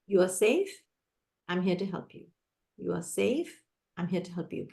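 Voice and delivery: soft, low reassurance; calm the frightened child gently; teacher soothing a scared child, softly. Shown as a demonstration. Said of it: "use this calm, soft voice and say".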